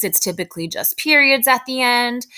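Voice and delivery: ordinary delivery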